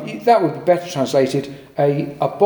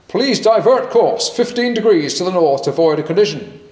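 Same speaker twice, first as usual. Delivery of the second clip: best attempt at an American accent. Americans radioing the Canadian navy to divert, in American accent. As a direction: put on an American naval radio voice. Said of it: "I'll do my best American accent".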